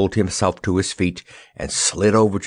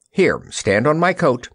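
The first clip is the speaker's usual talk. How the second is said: gallant; 6 semitones higher than usual. A narrator's reading. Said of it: "offered the Wizard gallantly".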